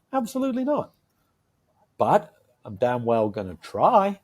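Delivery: normal talking voice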